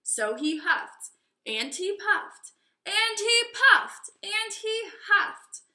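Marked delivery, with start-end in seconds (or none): 1.4-5.6 higher and louder, slowing down; storyteller's rising tension; building drama. A narrator's reading. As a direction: build the huffing to a peak. Narrating the huffing.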